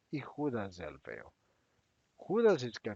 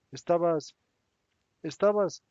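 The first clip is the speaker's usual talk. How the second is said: louder and higher, mocking each estabas; mocking emphasis; 5 semitones higher, 8 dB louder than usual. A narrator's reading. Bartolomé mocks Judas's old excitement with ironic emphasis. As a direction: jab the word estabas harder each time, higher and louder.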